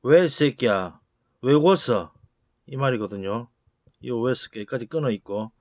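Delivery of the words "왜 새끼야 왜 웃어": louder, hostile challenge; angry yell. Acted. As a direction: snap at someone for smiling. Angry man confronting someone smiling. (시비 거는 화난 고함)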